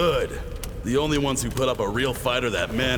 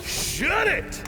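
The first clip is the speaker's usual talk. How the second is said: furious shouting; 12 semitones higher than usual. Acted. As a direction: scream at her to stop. Commander yells at singer.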